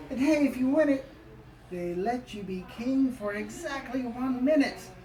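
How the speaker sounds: ordinary delivery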